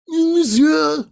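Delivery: a hammed-up French "Monsieur", high and loud; mock French. To the flight attendant, a mock French monsieur. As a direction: call out like a snooty Frenchman.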